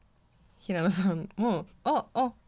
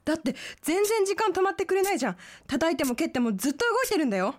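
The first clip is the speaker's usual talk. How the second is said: put-on panicking girlfriend; 8 semitones higher, faster than usual. Drama: panicking about the bomb.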